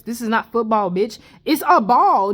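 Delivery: normal talking voice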